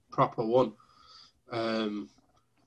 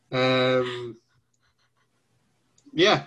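ordinary talk, nothing marked

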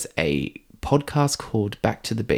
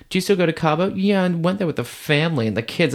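higher, chatty small-talk voice mocking the parents; mocking bland parents; 8 semitones higher, faster than usual. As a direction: imitate dull parents catching up over drinks. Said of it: "Parents talking about their kids".

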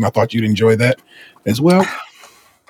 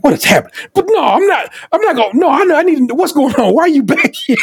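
much higher, puzzled pet asking what is going on; confused dog voice; 14 semitones higher than usual. Pretend. Dog wondering why its owner is behind it, high and puzzled. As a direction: play the dog nosing in, baffled and needy.

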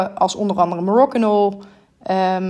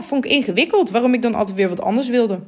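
normal talking voice

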